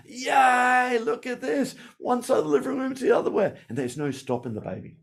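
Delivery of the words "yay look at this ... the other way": high, fast, excited cheering; cheering parent. Retold or imitated. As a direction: gush over the baby crossing the room. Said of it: "when the baby walks".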